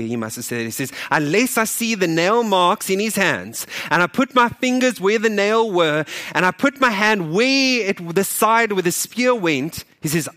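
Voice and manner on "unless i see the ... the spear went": retold angry demand, raised and loud; slow, emphatic, high-pitched indignation. Thomas answering disciples who saw Jesus, acted anger. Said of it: "I can hear it in his anger".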